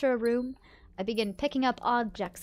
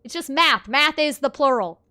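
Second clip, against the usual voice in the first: mock rant; 4 semitones higher, 11 dB louder, slower than usual. Ranting about maths.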